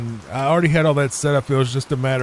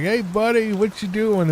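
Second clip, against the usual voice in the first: higher, sing-song voice for the dog; Mike cooing at the dog; 8 semitones higher than usual. Retold or imitated. Mike greets the wiggling dachshund, high cooing voice. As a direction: coo at a happy little dog. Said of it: "and he's like".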